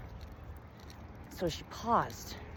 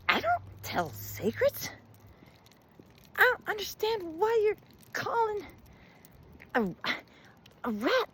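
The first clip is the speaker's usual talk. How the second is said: very high-pitched, halting protest; bewildered, indignant rat. A narrator's reading. Rat protests the slur to the old woman, indignant.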